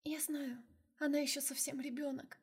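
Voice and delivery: high, soft, tearful voice; sobbing. Acted. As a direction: speak through a sob. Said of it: "всхлипнула Фэй".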